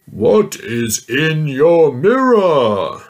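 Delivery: put-on mean giant yelling at the little girl. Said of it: "yelled the mean giant".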